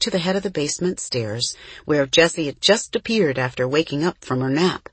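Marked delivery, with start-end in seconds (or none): none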